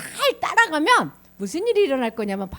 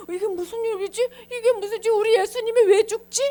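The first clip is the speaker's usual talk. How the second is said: high, anxious mock puzzlement; acted worried questioning; bewildered disciples; 10 semitones higher, 4 dB louder than usual. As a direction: fret in rising confusion. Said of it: "제자들이".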